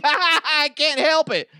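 very high, loud, drawn-out squeal; goofy giggling voice. Acted. Officer Kyle excusing his weird laugh, giddy high squeal. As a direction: blurt it through a silly high cackle. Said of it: "that weird laugh".

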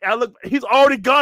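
usual speaking voice

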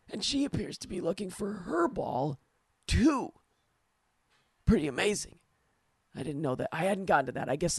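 put-on toddler telling a joke. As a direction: tell it like a two-year-old. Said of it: "picture two-year-old Dan telling this joke".

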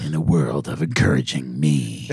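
deep dramatic trailer announcer; movie trailer voice. Acted. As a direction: intone it like an epic movie trailer. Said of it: "movie trailer voice you brought out tonight".

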